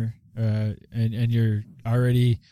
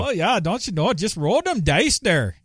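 put-on Minnesota accent; 6 semitones higher than usual. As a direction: play up a folksy Minnesota accent. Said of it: "the Minnesota accent impression".

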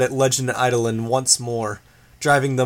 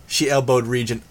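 ordinary delivery, nothing marked

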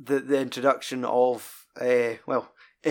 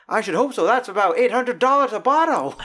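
much higher, louder, sniffy comeback; mock a snooty bystander, higher and louder; snooty man in the queue; 10 semitones higher, 6 dB louder than usual. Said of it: "the man behind on the queue is like".

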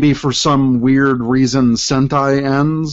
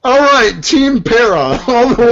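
cheering; 8 semitones higher, 4 dB louder than usual. Real, not acted. Cheering for team Para.

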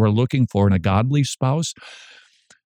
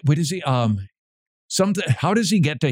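ordinary talk

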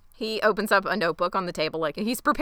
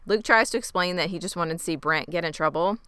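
normal talking voice

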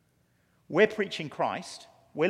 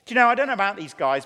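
ordinary delivery

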